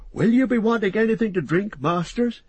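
high-pitched, obliging servant's voice; eager hobbit servant. Acted. Servant bringing candles to guests, eager to serve. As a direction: ask the guests in a high character voice.

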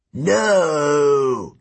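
loud, furious giant bellow. A narrator's reading. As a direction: bellow in rage. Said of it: "Antaeus bellowed".

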